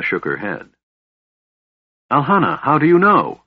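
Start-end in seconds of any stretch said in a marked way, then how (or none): 2.1-3.4 higher, louder, stern demand; firm, insistent questioning; sternly. A narrator's reading. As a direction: press her hard for the truth. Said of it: "Tanis asked sternly".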